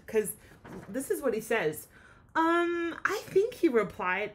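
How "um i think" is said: higher, louder nervous koala; hesitant reply. Acted. Frightened Kevin refusing the wombat's invitation, nervously. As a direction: answer timidly, unsure.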